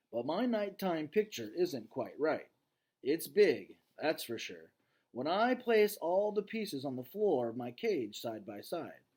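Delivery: story reading. A narrator's reading. Teacher reading the novel aloud to class, lively read-aloud. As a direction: read the chapter with energy to students.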